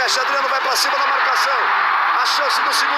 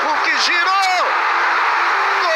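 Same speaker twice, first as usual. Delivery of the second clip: goal commentary shout; 6 semitones higher than usual. Acted. Live goal call.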